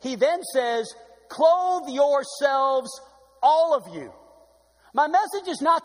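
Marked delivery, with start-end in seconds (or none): 1.3-4.1 CLOTHE stretched very slow and loud; preacher stressing the verse's key word, CLOTHE drawn out loud; drawn-out emphasis. Acted. Slow, loud, weighty verse.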